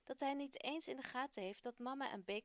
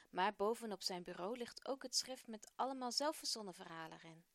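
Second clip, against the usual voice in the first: usual speaking voice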